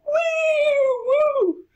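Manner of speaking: very high, loud, drawn-out "woo"; excited whoop. Real, not acted. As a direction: whoop like flying down a slide. Rides down a funnel.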